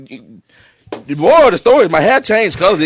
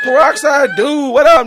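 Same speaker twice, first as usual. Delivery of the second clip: ordinary delivery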